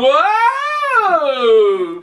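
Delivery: high, loud cry of alarm; startled whoa. Acted. Jack yelling as the snake rears back, high loud whoa. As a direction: yelp it out, hanging on for dear life. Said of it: "and he was going".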